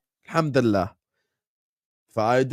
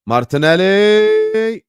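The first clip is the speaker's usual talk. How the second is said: drawn-out excited call; 9 semitones higher, 9 dB louder, much slower than usual. Real, not acted. Calling live chance on stream.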